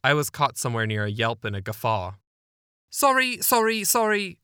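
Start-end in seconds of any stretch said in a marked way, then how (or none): 2.9-4.4 high, quick repeated sorry; sister apologizing. Retold or imitated. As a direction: apologize from outside the door, very high. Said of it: "she repeated outside the door".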